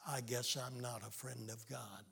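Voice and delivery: quiet, sunken admission; dejected. Retold or imitated. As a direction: drop the head and murmur it. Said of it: "the boy dropped his head and said".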